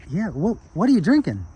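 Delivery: high-pitched, eager curiosity; cheerful small man. Acted. Small man looking up at a drunk, eagerly curious. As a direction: ask brightly, pitch leaping up. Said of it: "small Japanese man looks up at him".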